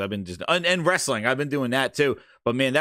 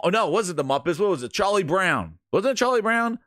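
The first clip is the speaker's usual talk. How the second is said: ordinary delivery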